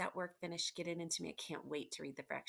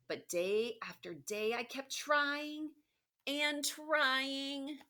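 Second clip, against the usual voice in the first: storybook read-aloud, a bit louder and lively; reading to children; 9 semitones higher, 5 dB louder, slower than usual. Acted. Teacher reading the book aloud to her class, lively. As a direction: read Humpty's words to the class.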